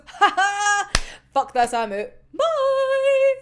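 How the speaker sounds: high gleeful laugh and goodbye; hammed-up gleeful escape; gleeful exit. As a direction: sing out the bye like a showman.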